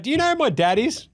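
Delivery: high, cheeky taunt; mock Bronny-style taunt; cocky kid taunt. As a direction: needle him like a brat. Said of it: "He's like".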